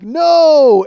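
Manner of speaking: high, very loud roar; imitated crowd shout. Fans answering the rappers, imitated crowd shout. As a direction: answer like a riled-up mob.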